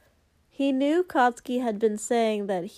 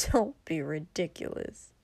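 cold strained; 5 semitones lower, 5 dB quieter than usual. Acted. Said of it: "cold and a little strained".

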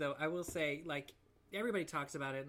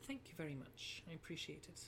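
soft, polite mock British; British accent; 8 dB quieter than usual. Shown as a demonstration. Co-host trying British manners, soft British accent. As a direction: put on a demure British thank-you. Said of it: "I'm gonna do British".

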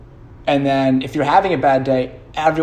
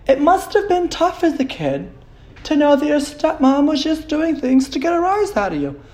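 high, soothing mock-therapist tone; 12 semitones higher than usual. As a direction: play an overly gentle therapist.

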